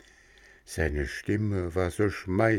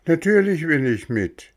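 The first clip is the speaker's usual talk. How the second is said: put-on higher, louder eager boy voice; a keen lad jumping at the offer; 9 semitones higher, 5 dB louder than usual. Boy Röhrle eagerly answers coachman.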